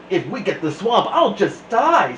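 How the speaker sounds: put-on panicky kid. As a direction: build into a panicky wail. Nervous kid fearing Miss Swamp.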